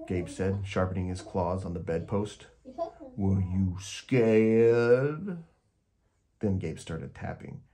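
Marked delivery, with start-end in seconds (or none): 3.2-5.4 very slow, loud, drawn-out monster question. A narrator's reading. Gabe checks if the child was scared, very slow loud monster voice. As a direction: stretch it out to spook the child.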